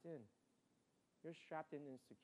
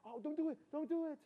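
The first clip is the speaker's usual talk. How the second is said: high, loud mock yell at the screen; imitating frightened mom; 10 semitones higher, 9 dB louder than usual. Son imitating mom yelling at a movie screen, high and loud. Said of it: "she'll start yelling and screaming at the screen".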